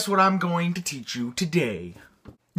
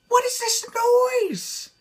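surprised. Real, not acted. Reacts to sudden noise.

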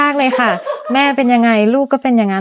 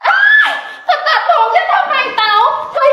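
laughing protest; 13 semitones higher than usual. Acted. Girl finds hair cut, laughing.

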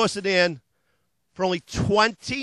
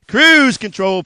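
"cruise control" hit louder, a quick sales punch; punched feature; 11 dB louder, much slower than usual. Acted. Dealer listing features to viewers, punched loud. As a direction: slam "cruise control" like a selling point.

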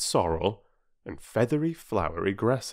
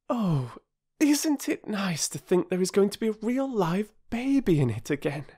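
put-on high, eager girl's voice; girl delighted at the news; delighted young girl; 13 semitones higher than usual. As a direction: sound thrilled about the baby.

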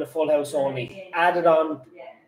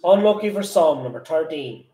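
bingo caller; 3 semitones lower than usual. Acted. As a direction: call the numbers like a bingo hall. Bingo number calling.

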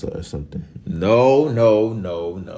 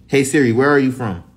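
calling Siri; 6 semitones higher, 7 dB louder than usual. Real, not acted.

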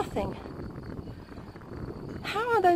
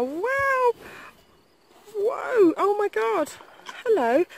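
high, breathless wow; awed; 10 semitones higher, much slower than usual. Real, not acted. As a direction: gasp it out in amazement. Horses arrive, high awed exclamations.

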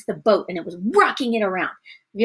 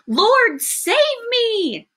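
acted desperate shout; 13 semitones higher, much slower than usual. Sinking Peter to Jesus, shouting desperately. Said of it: "he shouted".

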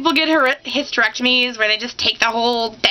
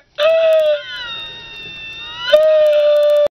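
wailing sob. Retold or imitated. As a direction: let out a big hammed-up sob. Crying over a movie.

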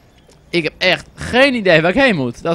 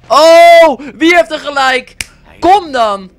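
much higher, loud gloating cry; triumphant shout; 10 semitones higher, 7 dB louder, much slower than usual. Real, not acted. Player gloating that his guess was right, loud triumphant cry. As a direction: crow over being proven right.

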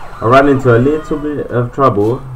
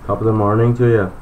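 a badly faked Irish lilt, quick; hammed-up Irish greeting to Burke; a weak stage-Irish hello; terrible Irish accent; faster than usual.

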